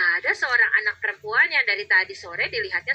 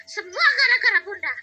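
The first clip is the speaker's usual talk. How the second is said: put-on a child tattling on mom; whining little girl; 12 semitones higher than usual. Child complains about mother. (anak kecil mengadu merengek)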